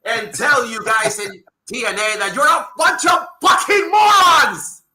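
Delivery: builds to a furious shout at morons; angry rant. Real, not acted. Podcaster yelling at the promotion, furious. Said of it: "take off my glasses and tell you".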